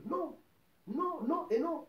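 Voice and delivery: chaque non plus aigu, lancé avec force; non martelé. Real, not acted. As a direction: marteler le refus, non après non. Refus véhément du péché. (non répété avec insistance, beaucoup plus aigu)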